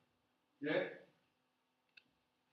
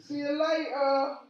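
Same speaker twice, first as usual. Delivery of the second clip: higher and louder, slow sing-song farewell; cheery goodbye; 8 semitones higher, 10 dB louder, slower than usual. Retold or imitated. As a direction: wave them off like a delighted salesman. Shop assistant waving goodbye.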